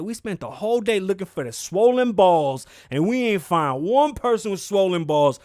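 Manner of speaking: loud, hammed-up president's voice; mock official outburst. President scolding [name] over swollen balls, loud mock outrage. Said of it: "He said".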